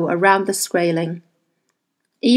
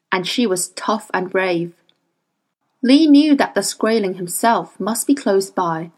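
plain reading voice

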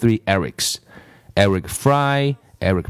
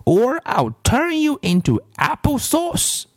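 higher witchy teacher threatening the apples; shouted threat. A narrator's reading. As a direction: threaten them like a cartoon witch. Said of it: "she shouted".

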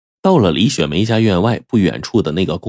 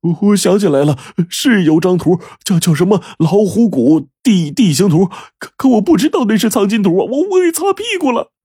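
much higher, stammering, frightened underling voice; 13 semitones higher than usual. Acted. Zhang Jun stammers to his boss that he used the map as toilet paper. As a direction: stutter out the confession in a panic. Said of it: "结结巴巴地说".

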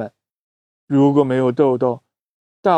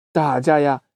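ordinary delivery, nothing marked

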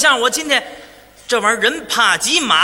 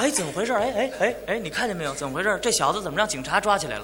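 围观群众嚷嚷; 6 semitones lower, 8 dB quieter than usual. Retold or imitated. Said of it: "趴窗户在那直嚷嚷".

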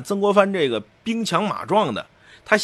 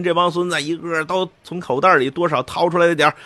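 ordinary talk, nothing marked

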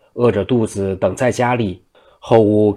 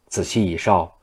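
ordinary delivery

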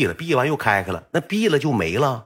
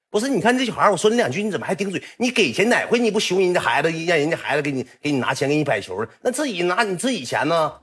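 put-on scolding old man; 7 semitones higher than usual. Old owner scolds drunk Yong.

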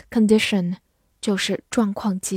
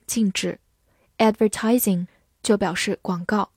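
ordinary delivery

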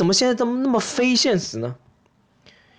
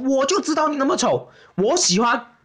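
acted declaration; 7 semitones higher than usual. Imagined date slaps table, declares love.

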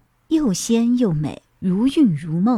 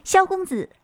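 much higher, delighted call of his name; joyful cry; 11 semitones higher than usual. A narrator's reading. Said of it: "一声欢呼".

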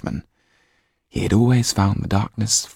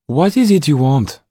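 higher, louder woman's voice, probing; Mrs Laxton's voice; 9 semitones higher, 7 dB louder than usual. A narrator's reading. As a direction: voice the lady asking firmly.

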